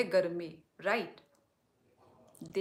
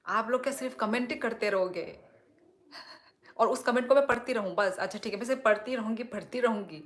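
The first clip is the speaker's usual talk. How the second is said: louder, higher and fast, fed-up complaint to chat; exasperated rant; 3 semitones higher, 7 dB louder, faster than usual. Real, not acted. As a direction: rattle it off in mock exasperation. Streamer annoyed at comment-only chat. (झुंझलाकर तेज़ और ऊँची आवाज़ में शिकायत)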